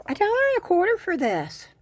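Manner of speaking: high, fast amazed exclamation. Real, not acted. Showing viewers the bargain, high and amazed.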